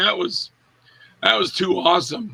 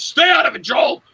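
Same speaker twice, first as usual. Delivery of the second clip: sharp angry yell, pitched up and louder; angry shout; 7 semitones higher, 3 dB louder than usual. Retold or imitated. Andrew yelling at Joel to stay out, angry shout. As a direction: snap at him to stay out.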